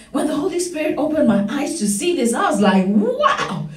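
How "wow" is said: high, swooping exclamation of wonder; excited awe. Retold or imitated. Preacher recalling her revelation to congregation, excited awe. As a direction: relive being amazed by a revelation. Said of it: "shaking and excited".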